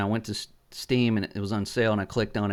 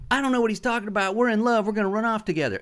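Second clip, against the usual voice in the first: higher, fast, lightly mocking young lover; lovestruck Jimmy; 9 semitones higher, faster than usual. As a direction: rattle it off like a smitten kid. Jimmy claims love for sister.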